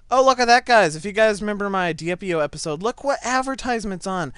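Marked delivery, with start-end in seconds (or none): none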